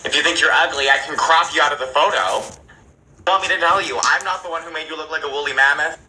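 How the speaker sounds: loud, cutting retort; snapping at a friend who fishes for compliments. Retold or imitated. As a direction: fire the insult back loudly. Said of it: "And I was like".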